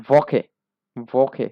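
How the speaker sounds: ভকে said loud, with the w as ভ; German w as v. Shown as a demonstration. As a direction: repeat ভকে clearly. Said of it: "ভে এর মতো উচ্চারণ হচ্ছে". (ভ উচ্চারণে ভকে)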